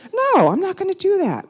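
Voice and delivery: high, loud indignant no; mock-outraged refusal; defiant refusal. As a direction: refuse it with pitch and volume jumping up. Retold defiant inner refusal.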